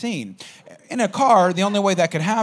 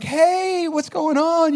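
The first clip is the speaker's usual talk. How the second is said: much higher, singing-out hello; imitated girl's greeting; 13 semitones higher, 4 dB louder, much slower than usual. Girl greets his friend in the car, chirpy imitation. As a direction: put on a high girly greeting.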